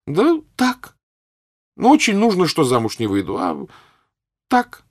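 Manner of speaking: very high, loud; girlish insistence. Acted. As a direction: daughter insisting to her mother. Daughter insists to mother.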